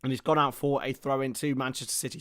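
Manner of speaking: steady live match commentary; play-by-play. Acted. Commentator calling the match for viewers, play-by-play. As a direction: narrate each pass as it happens.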